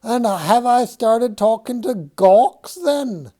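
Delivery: high, loud screechy ogress voice; ill-humoured ogress. Acted. Second shoe snapping back at George, ogress screech. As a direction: scold like a cranky ogress. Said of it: "in an ill-humoured ogress voice".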